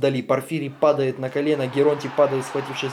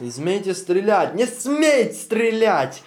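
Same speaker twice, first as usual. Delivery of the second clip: echoed plea; 6 semitones higher, 4 dB louder, slower than usual. Acted. Crowd voices echoing plea.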